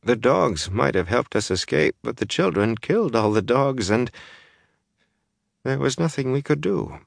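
high, dazed mumble; stunned woman's mumbled account; mumbling, swollen face. A narrator's reading. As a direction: recount it numbly through a swollen mouth. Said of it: "mumbling because of her swollen face".

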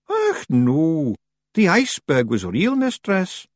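sheepish tiny Scots pixie; Feegle voice. A narrator's reading. Feegle answers.

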